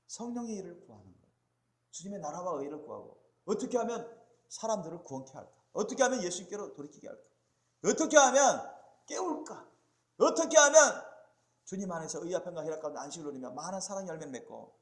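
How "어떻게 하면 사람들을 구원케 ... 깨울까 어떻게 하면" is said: repeated questions climbing to a loud, high cry; building preaching fervour. Real, not acted. Preacher asking how to save and awaken people, building fervour. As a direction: build from emphatic to near shouting.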